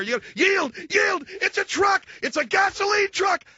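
high, loud alarmed yelling; mock panicked shout. Father shouting at son about the truck, loud and high. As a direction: bark the warnings way up high.

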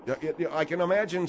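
usual speaking voice

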